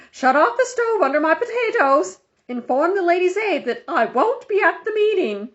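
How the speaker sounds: high, loud commanding yell from the stretcher. Acted. Injured woman yelling orders at the policeman, loud and high. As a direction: bark orders at the top of her voice. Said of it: "she yelled at the policeman".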